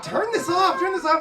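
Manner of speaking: high, loud panicked repeat; wife yelling to shut the song off; frantic shout. Retold or imitated. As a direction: yell at him to kill the music. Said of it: "And my wife was like".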